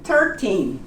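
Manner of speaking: Thirteen, th at the front, falling; thirteen demo. Shown as a demonstration. Teacher repeats Thirteen for students. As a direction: Thirteen with a careful th. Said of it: "the T H here in the front".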